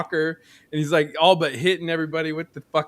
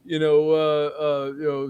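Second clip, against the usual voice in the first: ordinary delivery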